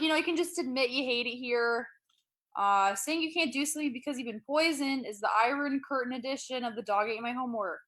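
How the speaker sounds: ordinary talk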